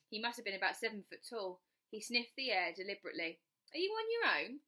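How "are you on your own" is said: a much higher, prying official's voice; a lightly voiced suspicious guard; nosy ticket collector. Tall ticket collector questions Bess.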